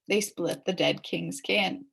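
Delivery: usual speaking voice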